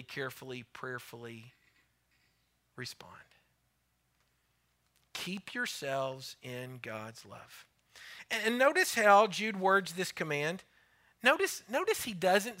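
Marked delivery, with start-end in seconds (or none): 0.0-3.1 softer and softer until whispered; fading to a whisper. Real, not acted. 5.1-7.6 soft refrain. Real, not acted. Soft refrain after the whisper.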